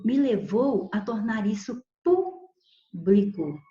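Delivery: ordinary talk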